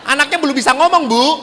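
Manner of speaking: incredulous retort. Retold or imitated. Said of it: "kata saya itu".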